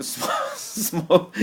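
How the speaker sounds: high, loud laugh cutting off the word; real uncontrolled laughter. Real, not acted. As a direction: crack up again mid-word. Cracking up mid-sentence.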